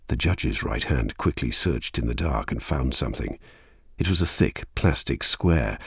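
ordinary delivery, nothing marked

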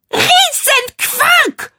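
very high, drawn-out shriek; slow, high outraged scream; furious shriek. A narrator's reading. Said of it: "schrie Jennifer".

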